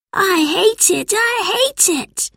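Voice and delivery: very high, slowing whine; whining little boy. A narrator's reading. Albert whines to George about school. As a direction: drag out the complaint.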